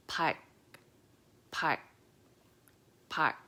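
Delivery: park with the L started then shut off by a back-tongue G; park, tongue tries L but the G stops it; L cut by G. Shown as a demonstration. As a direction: repeat park with a swallowed L-G ending. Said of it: "so you don't quite do the L sound".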